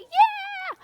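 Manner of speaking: high cheering yell; mock crowd cheer. Preacher imitates crowd welcoming Jesus, high cheer. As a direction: cheer like waving pom-poms. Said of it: "We're like".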